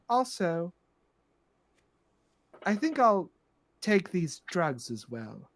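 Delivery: lightly voiced grande dame, slowing at the end; understated, entitled afterthought; composed, sinister madam. Plays Madam Storybrooke.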